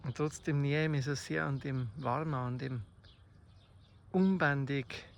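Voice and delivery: normal talking voice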